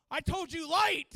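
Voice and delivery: loud, high pained complaint; scolding through the hurt; pained rebuke. Retold or imitated. Pastor scolding the soldier, loud pained rebuke. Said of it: "he said".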